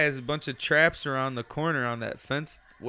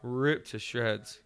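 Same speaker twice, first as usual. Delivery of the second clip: slow and lower, each word stretched; slow, drawn out; 4 semitones lower, 6 dB quieter, much slower than usual. Real, not acted. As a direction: drag it out heavily. Slow emphatic repeat.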